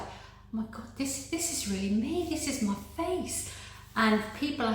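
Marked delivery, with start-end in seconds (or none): 0.0-0.9 breathless whispered gasp of disbelief; barely voiced, as if stunned; awed whisper. To her own reflection, a stunned awed whisper. 1.0-3.5 higher, thrilled and a little disbelieving; excited disbelief. Retold or imitated. Seeing her new face in the mirror, thrilled and higher. Amazed at own reflection.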